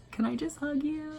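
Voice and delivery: high-pitched, timid mock plea; timid request. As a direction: ask it small and high, imitating a starstruck fan. Shy request to her idol.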